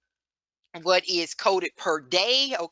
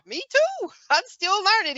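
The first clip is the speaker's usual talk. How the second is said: laughing; 13 semitones higher, 6 dB louder than usual. Real, not acted. Laughing at herself.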